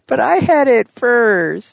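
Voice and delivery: put-on high, slow, whiny kid voice; childish whine. Childish bickering.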